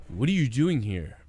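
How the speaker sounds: unbothered, lightly mocking; mock sudden calm. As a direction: drop from frenzy to casual. Abrupt calm after mimicked panic.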